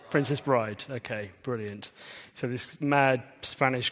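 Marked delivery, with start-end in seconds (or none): none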